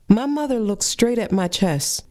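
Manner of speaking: ordinary delivery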